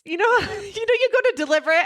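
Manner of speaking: high, laughing words through real giggles; laughing while telling the story to a friend. Real, not acted. Amused at her own mix-up, laughing through it.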